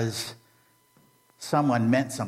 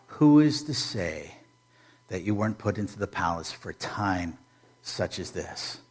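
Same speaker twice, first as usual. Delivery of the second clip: hushed whisper; 5 semitones lower, 5 dB quieter than usual. Acted. Quotes Mordecai's question.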